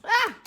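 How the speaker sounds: sudden loud "ah" of surprise; startled cry. Real, not acted. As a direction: jump at what she just heard. Startled by the spirit's reply.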